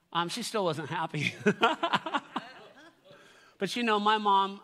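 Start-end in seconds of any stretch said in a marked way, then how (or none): 1.4-2.4 high-pitched chuckling laugh; amused laugh. Real, not acted. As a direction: laugh fondly at the memory. Laughs at mom's unhappiness.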